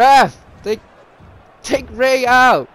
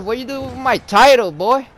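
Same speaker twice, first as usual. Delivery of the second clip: ordinary talk, nothing marked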